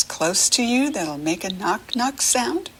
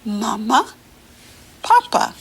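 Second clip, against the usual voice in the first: Papa jumps much higher, doll-like; talking doll voice; 4 semitones higher, 3 dB louder than usual. Acted. Teacher repeats the doll's words with kids, doll voice. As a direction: chirp it like a little toy doll. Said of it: "You say what the doll says".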